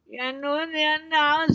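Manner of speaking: high, loud troll voice shaking with rage; furious troll. A narrator's reading. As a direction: spit it out, trembling with fury. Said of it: "rystede af raseri".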